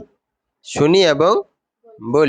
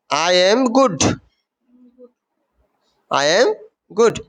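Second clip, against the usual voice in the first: I am good said very slowly; the teacher reads Sanjay's answer slowly for pupils; slow model reading; 3 semitones higher, much slower than usual. Shown as a demonstration. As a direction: draw out I am good word by word. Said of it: "teacher models the line".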